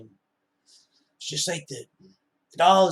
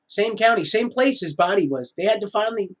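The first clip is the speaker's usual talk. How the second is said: louder, higher repeat; 7 semitones higher, 5 dB louder than usual. Real, not acted. Vlogger stressing the coincidence, louder and higher. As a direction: voice climbing on same county.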